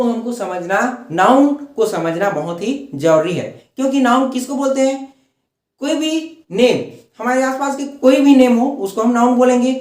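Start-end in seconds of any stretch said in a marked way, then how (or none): none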